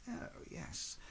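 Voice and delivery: a soft, breathy "Oh, yes" of discovery; hushed master. Acted. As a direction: let it slip out as a near-whisper. The master finds the amulet.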